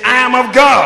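bold shout, high and very loud. Shown as a demonstration. Preacher urging the congregation to repeat it with boldness, bold shout. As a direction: belt it out with boldness. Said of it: "Say it with some boldness".

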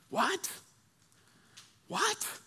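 tiny high-pitched squeak; mock-shocked falsetto question; stunned disbelief. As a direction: squeak it softly, rising, as if aghast. Buddies acted stunned by refusal.

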